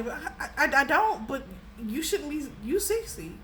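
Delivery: usual speaking voice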